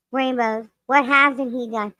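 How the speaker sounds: ordinary delivery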